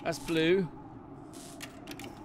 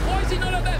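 high, louder frustrated cry; exasperated; 11 semitones higher, 6 dB louder, faster than usual. Real, not acted. Lost gamer yelling at the map in exasperation. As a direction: demand it of the game in exasperation.

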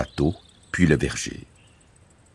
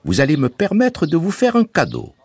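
higher, smiling voice for Bouzig; smiling offer; 13 semitones higher, 3 dB louder than usual. A narrator's reading. Bouzig offering the mother a gift, smiling. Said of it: "avec un grand sourire".

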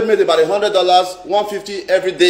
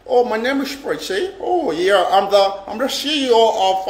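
fake caller voice; 3 semitones higher than usual. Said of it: "all this fake, fake life".

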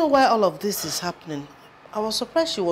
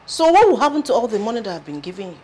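fast, higher-pitched protest; indignant demand; 6 semitones higher, faster than usual. Acted. Indignant about money she paid.

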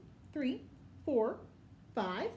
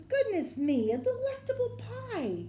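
delighted bear; 10 semitones higher, 6 dB louder than usual. Acted. Bear marvels at the pie his friends brought, delighted. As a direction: bear voice rising high and loud with delight. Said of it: "Bear says".

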